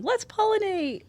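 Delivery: slow, high squeaky plant voice; plants talking. Pretend. Voicing plants eager to pollinate, slow high squeaky cartoon voice. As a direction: drawl it out as a cheerful cartoon plant.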